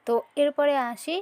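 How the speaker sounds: ordinary delivery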